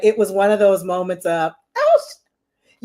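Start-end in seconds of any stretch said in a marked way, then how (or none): 1.8-2.8 very high, cut-off exclamation; high yelp, breaking off mid-word; starstruck gasp. Retold or imitated. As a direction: re-enact a squeal of shock. Re-enacts a starstruck moment.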